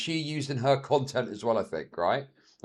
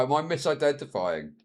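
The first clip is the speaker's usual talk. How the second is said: usual speaking voice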